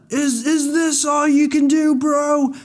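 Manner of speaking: very high, cocky put-on taunt; hammed-up swaggering dare; cool American taunt. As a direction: taunt the monster like a brash hero. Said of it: "the American part was so cool".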